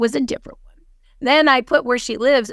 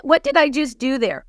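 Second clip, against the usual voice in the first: usual speaking voice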